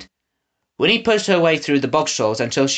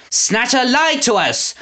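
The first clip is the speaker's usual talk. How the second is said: higher, slow, indignant accusing cry; angry shout; 10 semitones higher, slower than usual. A narrator's reading. Shopkeeper accuses Snatcher before the crowd, indignant shout. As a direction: call him out to the crowd. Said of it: "a shopkeeper shouted".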